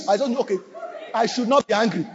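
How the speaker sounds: usual speaking voice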